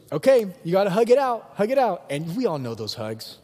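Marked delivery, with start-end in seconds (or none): 0.1-2.0 high, loud motherly order; bossy mom. Acted. Mom making siblings reconcile, bossy. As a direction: order the kids to make up, as mom. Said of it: "then she goes".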